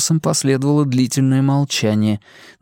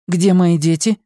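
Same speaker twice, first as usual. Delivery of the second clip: higher, urgent demanding questions; demanding woman; 7 semitones higher, 3 dB louder than usual. Acted. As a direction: press him for answers. Simona fearing for children.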